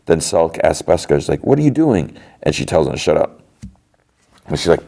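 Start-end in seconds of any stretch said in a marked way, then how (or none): 1.4-2.2 high-pitched retold disbelief; mock alarm. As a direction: imitate someone questioning a colleague in alarm. Alarmed challenge to gun-waving agent.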